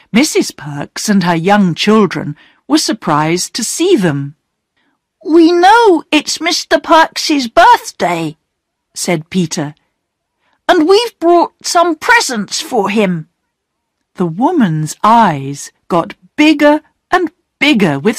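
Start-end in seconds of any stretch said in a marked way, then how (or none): 5.2-13.3 higher, lightly voiced eager boy; a boy bringing birthday presents, pitched up; boy Peter. Peter tells Mrs Perks about the presents, higher boyish voice.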